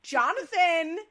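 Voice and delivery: high, loud mock mom calling Jonathan. Mocking the mom calling her son Jonathan. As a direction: ham up a prim suburban mom.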